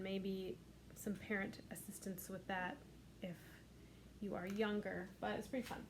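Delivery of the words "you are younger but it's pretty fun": usual speaking voice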